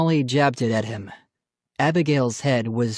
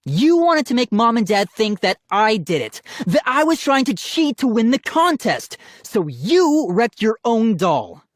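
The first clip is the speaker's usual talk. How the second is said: higher, raised indignant voice; heated accusation; 10 semitones higher, 4 dB louder than usual. A narrator's reading. Brother accusing sister of framing him, heated. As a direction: lay out the charge heatedly.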